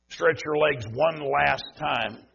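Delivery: slow, leaning hard on the word last; stress on last. Real, not acted. Preacher telling the congregation to stand, hitting "last". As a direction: make "last" land heavy, slowly. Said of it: "Heavy, heavy emphasis on the word last".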